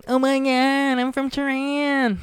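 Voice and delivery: high, slow nasal put-on Iranian accent; mock Aziz Iranian voice. Host imitating Aziz's Iranian bit, high and slow. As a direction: squeal it like a campy Tehran character. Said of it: "like, doing Aziz".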